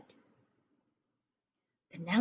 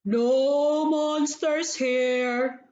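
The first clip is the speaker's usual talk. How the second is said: slow, booming giant; a huge giant answering slowly from the dark; very large voice; 4 dB louder, much slower than usual. Acted. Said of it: "said a very large voice".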